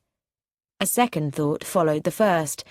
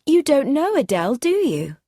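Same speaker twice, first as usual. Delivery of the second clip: higher and louder, keen to introduce; lively eager question; 7 semitones higher, 5 dB louder than usual. A narrator's reading. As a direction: push the question out brightly. Jennifer carries on her cry.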